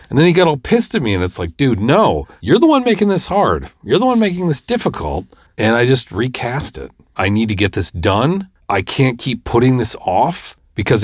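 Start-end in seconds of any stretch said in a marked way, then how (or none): 1.6-5.3 higher, emphatic, fed-up scolding; exasperated retort. Retold or imitated. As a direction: push back on a difficult actor. Retold argument with the actor.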